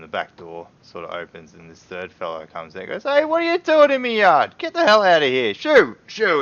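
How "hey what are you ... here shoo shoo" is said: put-on high, loud furious shout of an old dwarf chasing intruders; angry old dwarf. Old dwarf shouting at two men in his yard, high and loud. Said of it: "And it's this old dwarf".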